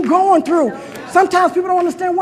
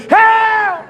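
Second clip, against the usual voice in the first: high, held cry; desperate high-pitched call for help; scream for help; 6 semitones higher than usual. Acted. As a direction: holler the word high and drawn out. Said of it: "I don't need to scream so loud".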